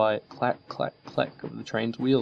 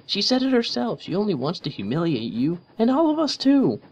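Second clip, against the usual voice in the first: heated Rainbow Dash; 13 semitones higher, 4 dB louder than usual. A narrator's reading. Rainbow keeps arguing.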